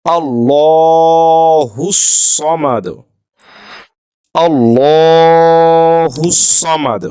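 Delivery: আল্লাহুস সামাদ chanted slowly with a thick, full লা; heavy Quranic recitation. Shown as a demonstration. Teacher reciting twice for the class, slow chant. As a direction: draw out আল্লাহুস, the লাম heavy like Bengali লয়. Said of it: "Bangla লয়ের মতো উচ্চারণটি হবে".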